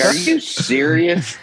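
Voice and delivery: amazed question. Retold or imitated. Fan brought two coffees.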